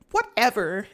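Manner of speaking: put-on high, dismissive teen brush-off; dismissive whatever. Sister brushing off her brother, dismissive. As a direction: toss it off like a bored little sister.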